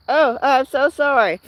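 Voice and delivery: ordinary talk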